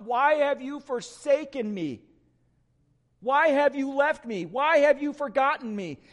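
Jesus crying out from the cross in anguish. Retold or imitated. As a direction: call out the why in anguish. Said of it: "he cries out".

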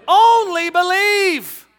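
Only believe shouted high and slow, Only stretched; preaching shout. Shown as a demonstration. Preacher echoing the congregation, loud and drawn out. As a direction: ring out Only believe with full force. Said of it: "everybody say".

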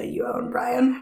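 whispered creepily at the end; creepy whisper. Acted. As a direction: breathe it out like a creepy secret. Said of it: "that's gonna make it sound weird".

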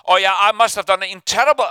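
normal talking voice

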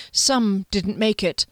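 ordinary delivery, nothing marked

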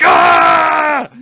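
loud, high-pitched "Ah!" yell; battle yell. Acted. Player's warrior ends the attack by yelling at the horse, battle yell. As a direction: scream it out at full force. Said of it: "end by yelling at it".

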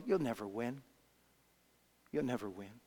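low, soft voice of doubt; fading taunt. Acted. Taunting waves, fading echo.

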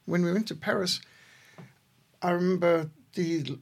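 normal talking voice

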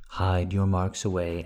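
ordinary delivery, nothing marked

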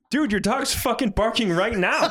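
high-pitched, amused disbelief; exasperated retort pitched high; retold incredulity. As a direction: call out the obvious, half laughing. Retelling own incredulous catch of caller.

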